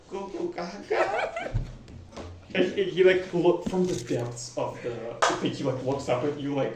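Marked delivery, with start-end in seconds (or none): 0.1-1.6 put-on slow, higher baby babble; Jonathan. Baby babbles in the party's arms, infant babble. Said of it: "Jonathan's like".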